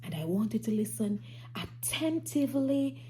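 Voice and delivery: hushed whisper to get attention; soft secret-telling whisper. Acted. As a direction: whisper it so they lean in. Teacher hushing children to listen.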